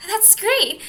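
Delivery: high, bright burst of joy; excited. Acted. Carrie thrilled to hear about a truce. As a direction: jump at the good news.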